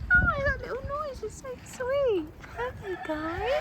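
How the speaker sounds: very high, softening coo; tender cooing. Real, not acted. Visitor charmed by a little animal noise, cooing high.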